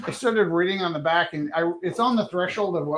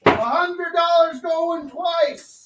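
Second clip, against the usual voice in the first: high, slow auctioneer call; 9 semitones higher, 4 dB louder than usual. Pretend. Calls the auction bids.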